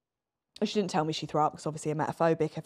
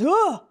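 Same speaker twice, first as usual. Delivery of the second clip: sharp, high ugh of disgust, much louder; 11 semitones higher, 7 dB louder than usual. Retold or imitated. Woman at traffic lights reacting to blindfold, loud high disgusted ugh. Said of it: "apparently goes".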